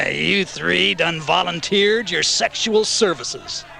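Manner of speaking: high, slow gloating drawl; demented villain. A narrator's reading. Wolf menacing three women after laughing, gloating maniacally.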